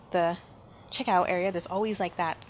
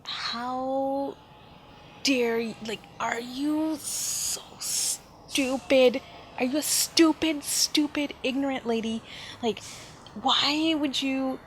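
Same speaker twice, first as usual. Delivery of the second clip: higher, slow, outraged rant; angry inner voice; 5 semitones higher, slower than usual. Retold or imitated. Child fuming silently at the woman, outraged. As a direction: spit out the insult in disbelief. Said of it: "basically just thinking".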